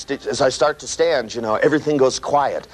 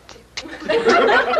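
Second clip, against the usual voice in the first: much higher clicked ticking noise; clock ticking; 13 semitones higher, much slower than usual. Shown as a demonstration. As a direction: tick it like a nervous clock. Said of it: "you hear tick".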